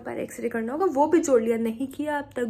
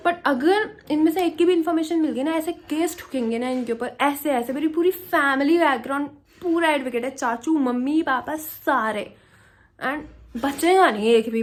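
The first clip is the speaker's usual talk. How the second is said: louder, heated threat of lawsuits; real anger; 3 semitones higher, 6 dB louder than usual. Real, not acted. She vents to viewers that her advocate family will sue them all, angrily. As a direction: vent it as a furious promise of revenge. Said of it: "मेरे को बहुत तेज गुस्सा आ रहा था".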